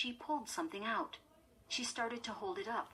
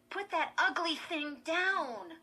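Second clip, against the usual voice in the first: high, louder, drawn-out order; impatient; 10 semitones higher, 5 dB louder than usual. A narrator's reading. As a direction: demand it with exasperation. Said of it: "Chris replied impatiently".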